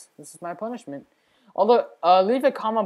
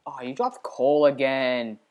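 ordinary talk, nothing marked

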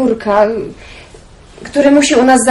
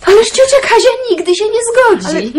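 alarmed objection; 9 semitones higher than usual. Acted.

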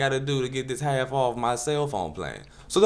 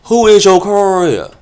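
much higher, loud camp voice; mock prissy guy; 9 semitones higher, 13 dB louder, slower than usual. Solicitor repeating his name, high and camp. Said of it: "that's him speaking clearly now".

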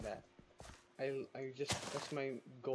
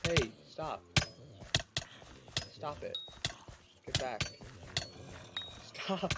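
sharp raised yell of stop; frustrated yell at zombies; shouting at mobs. Real, not acted. Yelling at attacking mobs.

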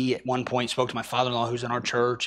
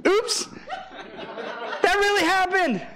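high, laughing aside; can't hold back the laugh. Real, not acted. Pastor laughing at the mix-up he just told the church. Laughing at his own story.

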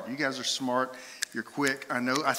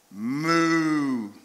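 cow moo. Shown as a demonstration. Pastor quizzing the congregation with animal sounds, mock cow moo. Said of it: "I'm gonna make a sound".

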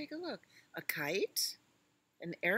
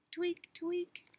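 high little tweak noises; squeaky tweak sound; 13 semitones higher than usual. Acted. Baby elephant signalling mama with high tweaks. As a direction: tweak like a tugged tail signal. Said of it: "tweak twice".

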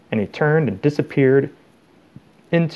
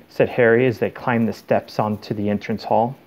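ordinary delivery

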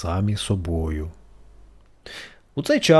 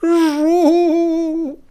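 very high, louder sobbing buzz; harder crying buzz; 7 dB louder than usual. A narrator's reading. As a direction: wail harder like a beetle. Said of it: "ще сильніше заплакав Жук". (жук плаче ще сильніше)

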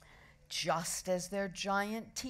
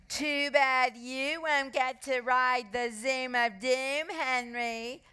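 high, slow singsong gloating; mock-sorry girl's tease; smug taunt; 6 semitones higher, slower than usual. A narrator's reading. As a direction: drag it out to rub it in. Smug girl taunts her rival.

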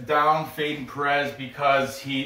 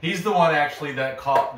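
usual speaking voice

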